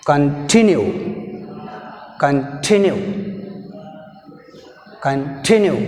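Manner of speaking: "continue" with weight on "tin"; short "con", then punch "tinue" in "continue"; stress on second syllable. Shown as a demonstration. Teacher showing second syllable pronounced aloud, stressed "tinue". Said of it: "We pronounce the second syllable".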